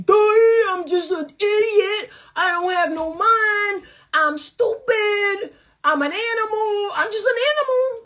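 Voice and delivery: high, dim-witted caveman voice, mocking; mocking dumb man. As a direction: whine it like a brainless brute making excuses. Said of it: "That's how you sound".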